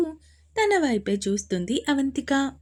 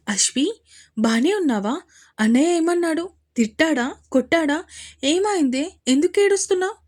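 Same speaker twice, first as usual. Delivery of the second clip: high, anxious questioning, louder and rising; fearful; 6 semitones higher, 4 dB louder than usual. A narrator's reading. Sister-in-law finding the bride in tears, alarmed. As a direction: fire off alarmed questions, pitch climbing. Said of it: "భయంగా అడిగింది అవంతిక".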